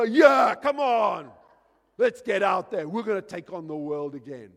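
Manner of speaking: big loud team roar; rallying cheer. Acted. Troops answering at halftime, roaring to get out there. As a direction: shout it like a fired-up squad. Team's roaring reply to coach.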